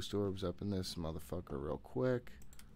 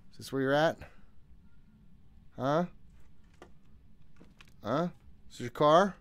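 higher and louder, mocking the ghost; taunting; 8 semitones higher, 3 dB louder than usual. Real, not acted. Player taunting the ghost while searching, loud mockery. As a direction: bait it, cocky and loud.

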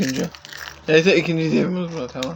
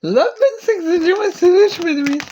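mock crying; 12 semitones higher than usual. Mock crying over losing.

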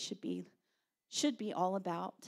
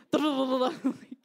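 loud rolled-tongue machine-gun burst; mock rattle of rapid fire; badly faked gun noise; imitated gunfire; 3 semitones higher, 7 dB louder than usual. Second try at gunfire noise.

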